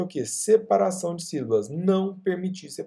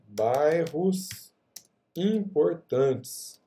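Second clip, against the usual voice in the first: slow, syllable by syllable; typing aloud; much slower than usual. Real, not acted. Teacher testing a longer name in the box, drawn out. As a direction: spell it out slowly as you type.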